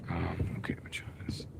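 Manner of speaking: barely audible whisper to himself; muttered whisper. Real, not acted. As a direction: whisper it under your breath while looking for a spot. Muttering to himself while parking.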